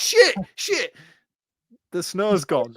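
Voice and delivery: mock panicked cry, high; hammed-up British panic. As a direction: pretend the snow loss is a disaster. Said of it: "the sheer panic".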